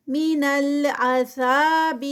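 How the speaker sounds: slow, drawn-out tajweed recitation; Quranic recitation. Shown as a demonstration. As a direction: chant it slowly, stretching each sound. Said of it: "ذا پہ ٹو counts". (آہستہ کھینچ کر تلاوت)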